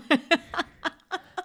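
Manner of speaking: high laughing burst; high amused laughter. Real, not acted. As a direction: crack up laughing. Laughing at the joke.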